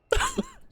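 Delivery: high panicked giggle; nervous squeal laugh. Real, not acted. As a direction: laugh out of fear.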